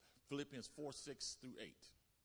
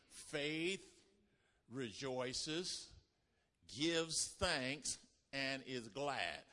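louder, stretched, glad declaration; joyful proclamation; 4 dB louder, much slower than usual. Acted. Preacher repeating faith's joy, louder and slow. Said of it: "I'll say it again".

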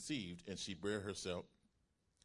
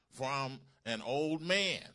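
slow, raised scripture reading; emphatic; 5 semitones higher, 9 dB louder, slower than usual. Acted. Preacher reading Zacharias's reply to the angel, emphatic. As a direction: read it out slow and loud, with weight. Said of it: "the Greek in this verse was emphatic".

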